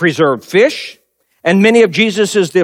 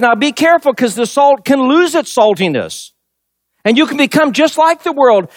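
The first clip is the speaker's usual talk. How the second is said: raised, higher preaching voice; 6 semitones higher than usual. Acted. Pastor warning his flock in Jesus' words, raised preaching. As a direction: press the warning home to the congregation.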